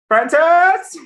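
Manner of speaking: loud repeated call. Acted. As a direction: shout the name across the inn. Calling out for the drawer.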